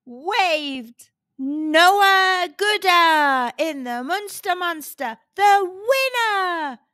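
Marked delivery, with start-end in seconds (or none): 1.4-6.8 high, loud, stretched winner announcement; loudspeaker announcer. A narrator's reading. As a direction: draw it out like a race announcer. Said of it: "came over the loudspeaker".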